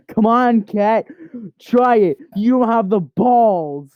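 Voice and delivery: high, loud taunting challenge; goading dare. Pretend. Owner dares the cat to attack, goading loudly.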